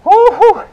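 very high, loud two-note whoop; high woo-hoo whoop. Real, not acted. Instructor pushing through last reps, high whooping cheer.

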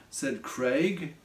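usual speaking voice

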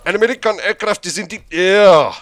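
shouted, high mock German accent, last word held long; bad German accent. Auditioning actor reads the kill streak line, loud botched German accent. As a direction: bellow it in a hopeless German accent. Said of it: "do it in your best German accent".

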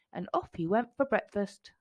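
plain reading voice

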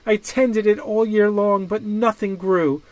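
put-on high, teary voice of a boy pleading; crying child. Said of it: "Ping started to cry".

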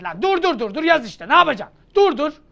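high, loud comic yell of stop; mock yell. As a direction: shout them down in mock panic. Teacher yells at student to stop. (yüksek sesle şakacı bağırış)

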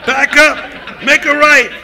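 high, loud steering calls; shouted directions. Acted. [name] goofing, yelling steering orders at the crew, high and loud. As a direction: call out like a nervous navigator.

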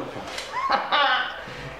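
nervous laugh. Real, not acted. Laughing after a near miss.

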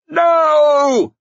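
very high, loud anguished shriek. A narrator's reading. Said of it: "shrieked Salt".

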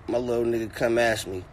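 ordinary talk, nothing marked

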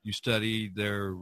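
ordinary delivery

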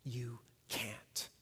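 soft menacing whisper; Satan's whisper. Acted. Satan whispering lies into the listener's heart, hushed and menacing. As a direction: breathe the lies like a tempter. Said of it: "Satan has been whispering those words".

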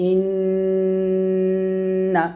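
ইন্না with the doubled n hummed long through the nose; nasal ghunnah. Shown as a demonstration. Teacher gives ghunnah example, ইন্না long and nasal. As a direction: hold the n of ইন্না steady in the nose, then release. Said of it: "এরকম".

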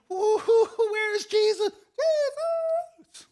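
very high, loud excited yell; screaming like a megaphone. Acted. Healed leper running to find Jesus, screaming at the top of his voice. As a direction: whoop and holler at full pitch. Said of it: "screaming at the top of his voice".

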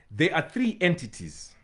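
ordinary talk, nothing marked

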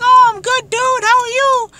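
very high, loud squealed reply; hammed-up falsetto of a delighted girl; gushing girl. Female acquaintance greeting him back, gushing falsetto. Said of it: "And she'll be like".

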